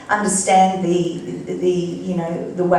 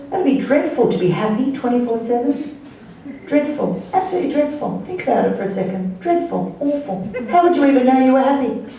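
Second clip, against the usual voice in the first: mock horror; 5 semitones higher, faster than usual. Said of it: "I go".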